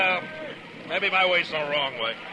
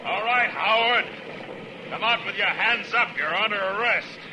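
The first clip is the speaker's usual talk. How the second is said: raised, commanding distant call; calling out an arrest; 4 semitones higher than usual. Acted. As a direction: order the hidden gunman out. Marshal calls to hidden gunman.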